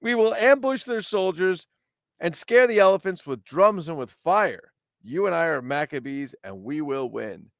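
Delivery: high, rousing commander easing down to the close; rally them for the ambush; hammed-up heroic battle speech; Judah the Maccabee.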